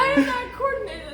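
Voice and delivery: high laughing, near tears. Real, not acted. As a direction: laugh through the words. Laughing at own failed run.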